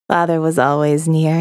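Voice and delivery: ordinary delivery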